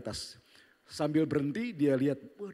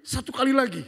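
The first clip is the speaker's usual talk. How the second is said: put-on eager child; 11 semitones higher, 8 dB louder than usual. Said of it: "Dia bilang begini".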